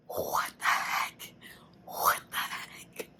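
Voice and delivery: slow, very soft whisper; stunned late-night whisper; hushed disbelief. Real, not acted. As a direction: breathe it out in shock.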